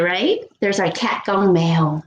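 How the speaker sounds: ordinary talk, nothing marked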